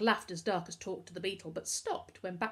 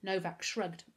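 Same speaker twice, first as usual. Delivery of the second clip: ordinary delivery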